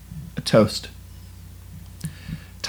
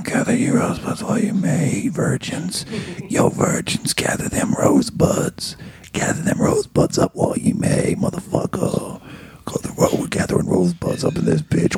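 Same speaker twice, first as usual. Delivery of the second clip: loopy whispered street riff; 3 semitones higher than usual. Acted. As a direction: whisper it like a hype man.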